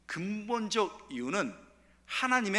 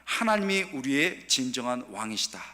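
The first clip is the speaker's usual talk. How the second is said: call and response; 3 semitones lower than usual. Shown as a demonstration. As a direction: lead the congregation line by line. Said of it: "한번 따라해보겠습니다".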